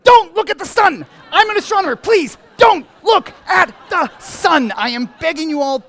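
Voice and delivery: very high, loud exasperated yelling; frantic pleading shout. Acted. Astronomer begs audience not to look at sun, frantic shouting. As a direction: shout the warning as if begging.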